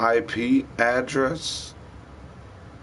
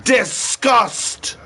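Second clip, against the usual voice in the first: disgusted outburst; 10 semitones higher, 5 dB louder than usual. Real, not acted.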